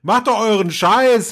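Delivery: hammed-up angry Kinski yell; Kinski outburst. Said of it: "hätte der Kinski gesagt".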